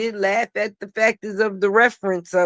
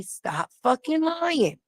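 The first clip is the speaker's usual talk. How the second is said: slow drawn-out scold; 6 semitones higher, 4 dB quieter, slower than usual. Retold or imitated. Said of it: "They have to say".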